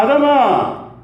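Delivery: loud, high calling voice of God; booming call of God searching for Adam. Acted. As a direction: call out for Adam across the garden.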